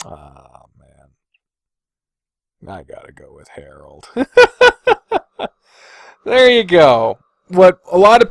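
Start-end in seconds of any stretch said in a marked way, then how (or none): 0.1-4.1 much softer and lower, giving in; almost under the breath, giving in to the choice; quiet resigned choice. Real, not acted. Choosing between the final two, quiet and resigned. 4.1-5.5 a sudden high, loud laugh of relief; high burst of laughter. Real, not acted. Final winner picked, a high relieved laugh.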